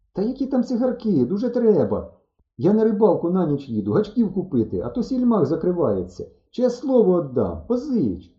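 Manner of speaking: high-pitched, insistent persuasion; urgent pleading. A narrator's reading. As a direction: plead your case, voice lifted. Pleading for a loan, denying cigarettes. (благально, схвильовано)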